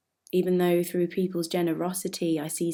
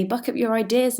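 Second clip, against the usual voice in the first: raised, louder telling-off; brisk scolding; 6 semitones higher, 5 dB louder than usual. Acted. Label taking a musician by the ear, scolding. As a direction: give a mock pep talk, pitched up.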